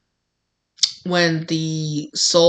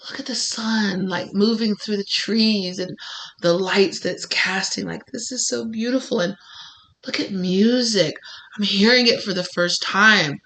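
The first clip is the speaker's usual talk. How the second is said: hushed wonder; 2 semitones higher than usual. Acted. Voices soul's first wonder at Earth.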